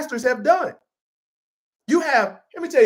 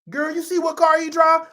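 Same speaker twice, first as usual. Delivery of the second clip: higher, excited mock gossip; lightly voiced gossip girl; gossiping girlfriend; 7 semitones higher than usual. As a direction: nudge your friend about his car. Imitating gossiping woman again.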